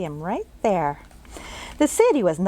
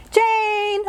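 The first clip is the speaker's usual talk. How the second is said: much higher calling voice; calling mother; 12 semitones higher than usual. Acted. Mother calling Jane down to dinner from another room. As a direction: call from the next room. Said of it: "a woman's voice came".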